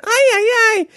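high, sing-song Latin American exclamation; imitating the nanny's Latin American accent. Imitating the nanny fretting about the kids, high Latin American accent. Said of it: "let's just do with that accent".

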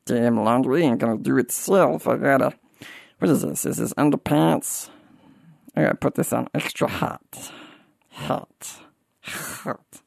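grumbly cartoon muttering, fading to a voiceless whisper; Meatwad. Cartoon voice muttering to himself over the laundry, grumbling. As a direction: mumble through chores in a put-on cartoon voice, trailing away. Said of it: "walking around the house going".

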